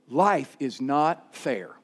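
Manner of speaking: low, soft, slow after a gasp; stunned realization. Retold or imitated. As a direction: let the truth sink in slowly. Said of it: "where we go".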